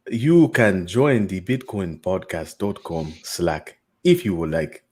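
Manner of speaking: slow, low stab at a Wakandan accent; attempted Wakandan accent. Shown as a demonstration. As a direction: have a go at a Wakandan accent, taking it slowly. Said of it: "as close as I'm gonna get".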